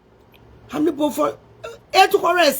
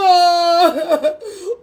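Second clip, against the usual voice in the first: laugh-cry. Real, not acted. Laughing while crying.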